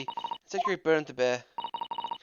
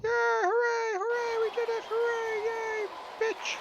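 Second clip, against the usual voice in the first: high, squeaky fake cheering; squeaky mock celebration; slower than usual. Fake cheering at a court win.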